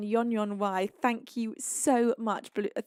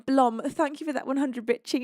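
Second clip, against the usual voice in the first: ordinary talk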